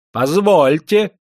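high, loud, drawn-out objection; insist, refusing to calm down; persistent protest. A narrator's reading. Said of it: "не унимался проезжий". (громкий, настойчивый протест)

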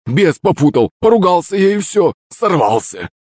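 high, whining apology, falling away; drunken excuse. A narrator's reading. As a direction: plead guilty like a scolded drunk. Drunk excuses himself to master. (пьяное оправдание)

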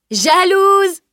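high, loud playful cry; cri rieur. Acted. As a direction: shout it as a teasing taunt. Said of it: "cria Salim en riant". (cri aigu et moqueur, pour rire)

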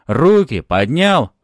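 loud, high, stretched command; shouted order. A narrator's reading. Cop ordering hero's hands up, shouted. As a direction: bellow the order slowly.

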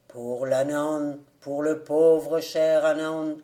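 low, slow wailing beadle's cry; lamentable bellowing. A narrator's reading. Beadle bellowing for the souls, slow and lamentable. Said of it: "clamait-il".

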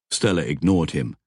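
plain reading voice

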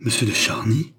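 breath-caught, incredulous question, rising at the end; gasped astonishment, only mildly explosive; startled exclamation. A narrator's reading. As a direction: echo the name in shocked disbelief. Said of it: "s'écria André avec une explosion effrayante".

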